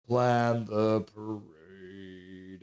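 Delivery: deep drawn-out announcement. Shown as a demonstration. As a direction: drop low and drag it out like a trailer voice. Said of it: "Was that pretty good?".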